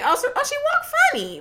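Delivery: high-pitched, harmless observation; re-enacted innocent aside; innocent remark. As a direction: say it lightly, way up high, no shade meant. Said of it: "I wasn't being shady".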